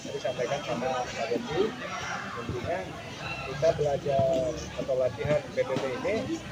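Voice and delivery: low, soft explaining voice; calm briefing. Real, not acted. Instructor calmly briefing cadets after a break. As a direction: talk them down gently.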